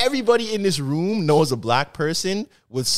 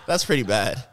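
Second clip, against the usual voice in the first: usual speaking voice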